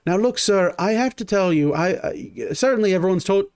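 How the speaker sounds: a nervous guard talking through the slot; gate guard. Acted. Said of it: "and you hear".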